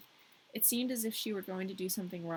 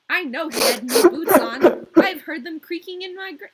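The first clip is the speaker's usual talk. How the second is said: plain reading voice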